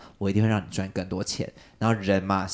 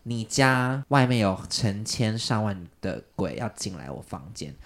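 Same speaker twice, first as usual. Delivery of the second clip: ordinary talk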